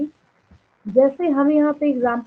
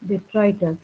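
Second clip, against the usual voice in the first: very slow, lower dictation voice; slow, measured read-out for note-takers; 4 semitones lower, much slower than usual. Real, not acted. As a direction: dictate word by word, leaving long gaps. Dictates notes while writing.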